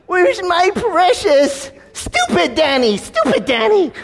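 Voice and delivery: high, drawn-out creature wail; loud, hammed-up high impression, words stretched out; Smeagol. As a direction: shriek like a wretched creature mourning its treasure. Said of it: "I became like Smeagol".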